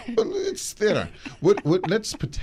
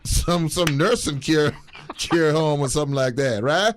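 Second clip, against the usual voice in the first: higher, half laughing, a light Trump voice; mock Trump; 5 semitones higher than usual. Playing Trump quizzing the voter about his family, half laughing. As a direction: fake concern with a laugh. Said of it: "now I'm Donald Trump".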